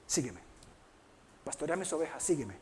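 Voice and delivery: soft, low, unhurried command; gentle quiet call. Acted. Jesus restores Peter and calls him to follow, softly. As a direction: call him quietly, with space between phrases.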